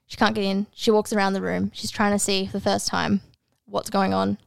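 ordinary talk, nothing marked

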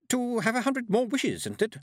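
high-pitched, tentative woman; hesitant answer. A narrator's reading. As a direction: offer a guess in a high voice. Miss Tick answers hesitantly.

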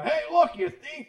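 loud, high yell; shouting at thief. Retold or imitated. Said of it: "and you go".

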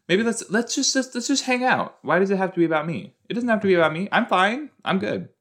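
lightly voiced teen girl, high; high voice as Cleo. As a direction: play her, pitch way up. Voicing Cleo's wish, high.

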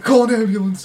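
mock panic, yelling call an ambulance; retold panicked shout. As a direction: gasp then a high, loud cry for help. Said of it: "and I'm like".

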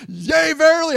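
a hint of churchy fervor; King James phrase. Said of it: "You might hear me say".

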